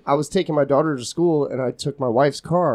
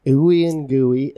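drawn out relish; 2 semitones lower, much slower than usual. Real, not acted.

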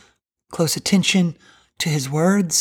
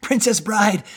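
normal talking voice

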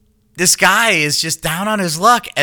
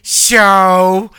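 Coolidge voice; 4 semitones higher, 7 dB louder than usual. Shown as a demonstration. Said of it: "C J-ish in that voice".

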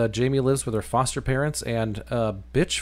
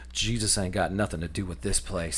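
dramatic security guard; 5 semitones lower, 7 dB quieter than usual. Retold or imitated. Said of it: "stops all dramatically".

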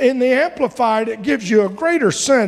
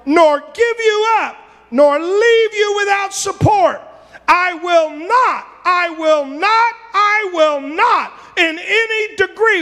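preaching at full force; 8 semitones higher than usual. Acted. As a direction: pound every not like a vow. Preaching God's promise, shouting.

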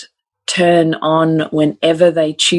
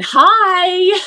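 put-on squeaky little-girl hi, pitched higher; high toddler hi. Mother imitating three-year-old grabbing the microphone, high chirpy hi. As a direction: chirp it like a tiny kid into a mic. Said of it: "grabs the microphone".

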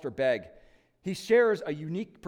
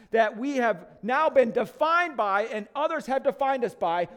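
louder and higher, preaching with full conviction; preaching at full force; 6 semitones higher, 5 dB louder than usual. Acted. Pastor proclaiming freedom from sin to congregation, full-force preaching. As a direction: proclaim it to the whole church, rising.

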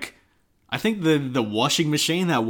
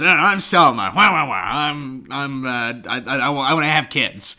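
whiny mock Selma; muffled wah wah talk; 2 semitones higher, 6 dB louder than usual. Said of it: "she says".

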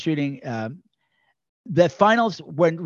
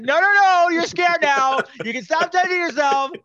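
very high, loud mock panic; mock-frantic shouting at one extra to stop; exasperated director yell; 12 semitones higher, 4 dB louder than usual. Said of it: "And he's like".

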